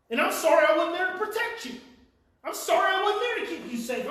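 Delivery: loud, rising angry outburst; mad for you. Real, not acted. Speaker to hurting child, mad on their behalf, loud angry outpouring. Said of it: "that was mad right there".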